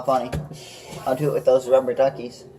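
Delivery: ordinary talk, nothing marked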